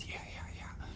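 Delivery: whispered, gleeful yeah yeah yeah; whispered gloating. Retold or imitated. The punished boy whispering gleefully to himself. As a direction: gloat under your breath.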